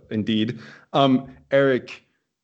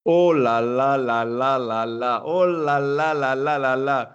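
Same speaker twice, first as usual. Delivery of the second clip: sustained French commentator cry of amazement; 2 semitones higher, 3 dB louder, slower than usual. Acted. Host opens the show on two French semifinalists, as French commentator. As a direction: draw it out loudly like an excited French commentator. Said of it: "that's my French commentator".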